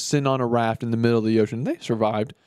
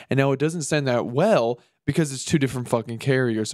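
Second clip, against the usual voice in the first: usual speaking voice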